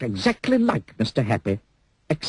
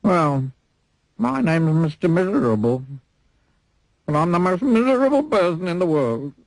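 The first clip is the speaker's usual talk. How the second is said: lightly voiced Mister Miserable; 9 semitones higher than usual.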